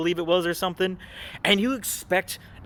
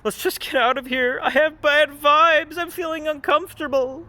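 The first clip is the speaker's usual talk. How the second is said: high mock fretful voice; mock scared girl; 10 semitones higher than usual. Commentator mimicking Binks wanting to leave, high and fretful. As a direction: whine it like a nervous girl. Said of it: "mimicking Binks".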